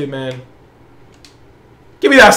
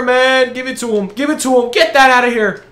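ordinary talk, nothing marked

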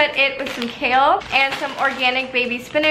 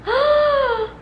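delighted gasp. Real, not acted. Tasting the salad.

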